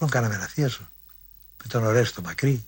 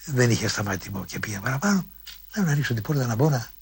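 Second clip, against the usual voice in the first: usual speaking voice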